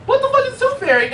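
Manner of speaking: put-on high, fast little girl voice; high quick kid voice, baffled; little niece voice. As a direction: play the baffled four-year-old. Four-year-old niece voiced.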